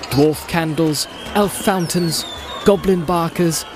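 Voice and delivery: plain reading voice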